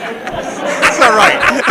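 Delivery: words broken by laughter; high, laughing through the words. Real, not acted. Cracking up at drunk talk.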